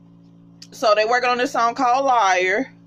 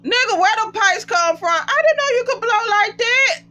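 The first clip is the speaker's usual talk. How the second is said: pitched way up, stunned and thrilled; amazed; 12 semitones higher than usual. Real, not acted. Vlogger amazed at Oliver's singing, high excited disbelief. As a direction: shout out your shock at his voice.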